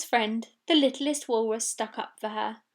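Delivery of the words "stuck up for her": ordinary delivery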